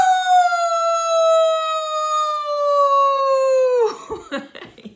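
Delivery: long, very high, loud howl; werewolf howl. Pretend. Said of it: "we're gonna do a werewolf howl".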